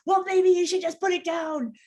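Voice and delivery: mock her own sharp impatience; agitated snap. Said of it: "I'd get agitated and angry".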